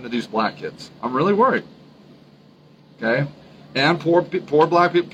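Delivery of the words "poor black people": ordinary talk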